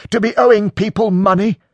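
high, loud rising question; heated outrage. A narrator's reading. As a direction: press the accusation as an outraged question. Acted quarrel, pressing the point.

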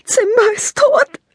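put-on high, loud child's voice breaking into tears; tearful girl. A narrator's reading. Lila tells her friend her rabbit died, in tears. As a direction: sob it out like a heartbroken little girl. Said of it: "Da bricht Lila in Tränen aus".

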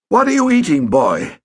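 irritated stepfather demanding an answer. A narrator's reading. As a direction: snap at the boy with irritation. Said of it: "demanded Mister Pett".

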